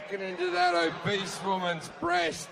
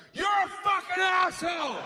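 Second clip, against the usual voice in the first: furious shout; 11 semitones higher than usual. Retold or imitated. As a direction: scream it at the comedian like a furious fan. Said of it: "she'll go".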